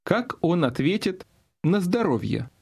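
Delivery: normal talking voice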